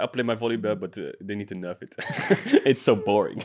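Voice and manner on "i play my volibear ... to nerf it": put-on Dutch accent. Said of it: "in his Dutch accent".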